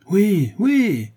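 crowd's cry. A narrator's reading. The wheelwright's friends answer him, crying out. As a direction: cry out yes like a rallied crowd. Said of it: "crièrent les amis du charron".

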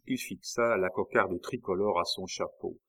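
usual speaking voice

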